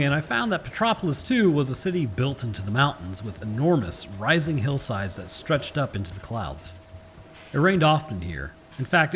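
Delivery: ordinary delivery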